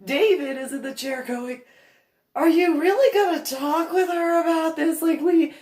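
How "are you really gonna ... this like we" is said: exasperated husband. Retold or imitated. Husband protesting, voiced higher.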